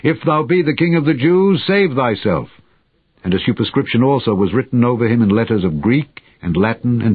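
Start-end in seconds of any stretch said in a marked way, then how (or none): 0.0-2.5 lightly voiced soldiers' taunt, pitched well up; mocking. A narrator's reading. Soldiers mocking the crucified king, a lightly voiced raised-pitch taunt. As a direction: read it as a scornful, raised-pitch jeer. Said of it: "the soldiers also mocked him".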